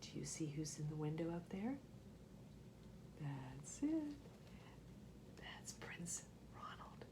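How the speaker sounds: soft, conspiratorial whisper. Real, not acted. Storyteller to the children, pointing out Ronald, whispering.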